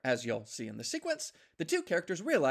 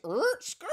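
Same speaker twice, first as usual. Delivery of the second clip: mock skid noise; 5 dB louder than usual. Chariot skidding off.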